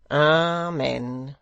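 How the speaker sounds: loud, drawn-out pious amen; solemn amen. A narrator's reading. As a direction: intone it with mock-holy weight. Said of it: "with a solemn face".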